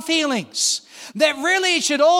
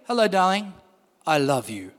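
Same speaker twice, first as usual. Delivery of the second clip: emotionless; 8 semitones lower than usual. Retold or imitated. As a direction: say it like it means nothing to you. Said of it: "with no emotion".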